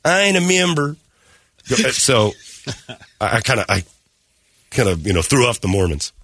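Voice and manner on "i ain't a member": put-on hillbilly contractor. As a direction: lay the hillbilly accent on thick, pitched up. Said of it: "why did I use hillbilly voice?".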